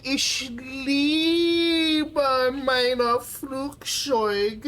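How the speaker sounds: high, slow hammed-up German accent; mock German accent. Streamer hams up German for chat, in a mock German accent. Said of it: "real German accent".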